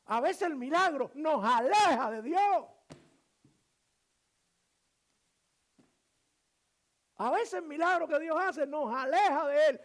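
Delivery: impassioned, high and loud sermon delivery; full-force preaching. Real, not acted. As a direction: drive each phrase home to the congregation.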